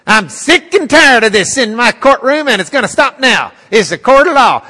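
furious dressing-down from the bench, rising to a yell; furious judge scolding a lying expert witness. Retold or imitated.

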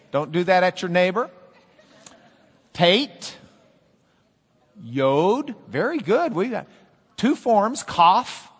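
usual speaking voice

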